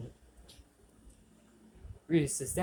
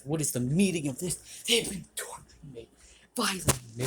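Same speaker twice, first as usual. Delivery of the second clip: louder, indignant demand, voice rising; angry outburst; 2 semitones higher, 7 dB louder than usual. Acted. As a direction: throw the accusation back at him. Issei confronts Reese's dad.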